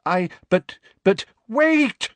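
high, loud stammering cry; sudden outburst. Acted. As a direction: blurt it out in a panic. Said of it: "said Professor Trelawney suddenly".

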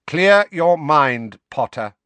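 slow, loud, icy order; cold command. A narrator's reading. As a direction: command coldly, drawing out each word. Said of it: "said Snape's cold voice".

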